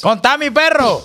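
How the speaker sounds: high, drawn-out call to a buddy; stretched, high-pitched prompt. Real, not acted. Calls on friend to tell it.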